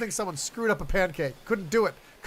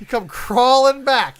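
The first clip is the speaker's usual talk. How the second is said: taunting; 5 semitones higher, 9 dB louder, much slower than usual. Acted. Mocking slow taunt.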